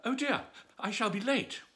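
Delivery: flustered rabbit fretting he'll be late; high worried little rabbit. A narrator's reading. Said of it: "heard the rabbit say to itself".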